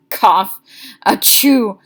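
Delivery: loud cough, then a loud sneeze. A narrator's reading. Boy at the lunch table coughing and sneezing out crackers.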